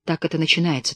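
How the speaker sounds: ordinary delivery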